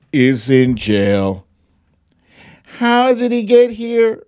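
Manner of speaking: high, slow storybook reader; mock storybook narration. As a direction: read it like a children's tale, high and drawn out. Reads a picture-book parody aloud.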